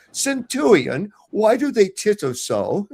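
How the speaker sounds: high, slow, lisping and pompous; lisping Pilate. Retold or imitated. Pilate asks his centurion why the crowd laughs, in a high, slow lisping voice. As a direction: drag it out like a baffled lisping governor. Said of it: "he's got a speech impediment".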